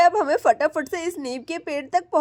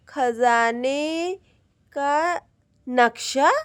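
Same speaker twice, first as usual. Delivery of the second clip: drawn-out syllables, as if reading with effort; puzzling out the words one by one; slowly spelled out; 4 semitones lower, much slower than usual. Acted. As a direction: sound out each word slowly. Slowly deciphering the map.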